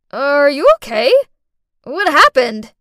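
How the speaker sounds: alarmed. Acted. Rushing to fallen child.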